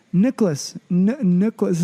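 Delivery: Kiwi necklace said like Nicholas; mock Kiwi accent. Kiwi girl saying necklace her way, mock Kiwi accent. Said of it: "She's like".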